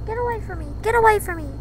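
high, frantic yelps to get away; panicked cry. Real, not acted. Gamer fleeing a cow, shrieking high in panic. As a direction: screech it in real panic.

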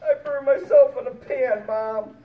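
put-on high, loud little-boy wail; mock crying. Burned boy telling his mom what happened, mock crying. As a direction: wail it like a hurt four-year-old, hammed up. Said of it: "I cried so hard".

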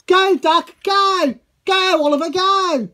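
high, loud rallying shout; loud cheering. Pretend. Cheering Oliver and Toad off first, loud high shout. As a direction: cheer them on at full voice.

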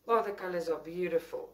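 normal talking voice

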